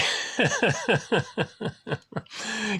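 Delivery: amused laugh. Real, not acted. Laughing after own demo.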